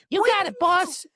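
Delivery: cartoonish high assistant answering the boss; eager employee voice. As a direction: chirp it like a keen underling. Playing eager employee Jimmy.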